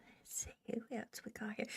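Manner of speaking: fast, soft whisper; hushed aside to herself. Real, not acted. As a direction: whisper it under your breath. Whispered aside while browsing.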